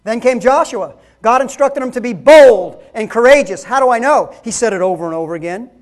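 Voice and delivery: loud, high fiery preaching; preaching at full force. Acted. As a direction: preach it hard to the congregation. Preaching loud about Joshua.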